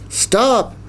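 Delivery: high-pitched urgent stop; mild yell. A narrator's reading. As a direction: cry out to halt the giant. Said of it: "Jackie yelled".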